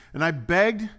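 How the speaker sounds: high, loud begging cry; desperate father. Acted. Preacher voicing the father's plea, loud and high.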